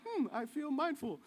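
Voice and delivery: a light, dreamy hum of mock mindfulness; mock serene. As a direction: play the newly enlightened. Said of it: "I really do walk away like".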